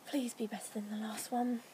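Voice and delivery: hushed, hopeful whisper; whispered plea. Real, not acted. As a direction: whisper it like a small prayer. Whispered hope before tasting.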